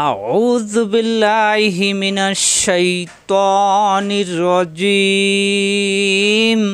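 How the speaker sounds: slow stretched melodic chant; Quran recitation. Shown as a demonstration. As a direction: intone each word, drawn long. Recites taawwuz and basmala.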